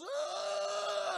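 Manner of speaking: high-pitched sustained comic scream; mock scream. Girl lifted in the air on blanket, mock scream. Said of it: "she's all screaming and shit".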